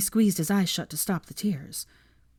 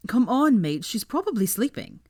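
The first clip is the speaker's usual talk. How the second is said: shouted; 5 semitones higher than usual. A narrator's reading. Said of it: "shouted Blaze".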